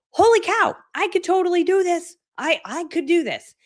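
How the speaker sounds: light up with sudden belief; amazed excitement. Pretend. Voicing what the audience should feel after the event, thrilled. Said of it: "walk away from this event being like".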